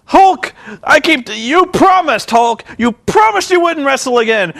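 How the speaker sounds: high-pitched pleading outrage, hammed up; pissed-off rant. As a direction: imitate a betrayed friend ranting in protest. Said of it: "and he's pissed".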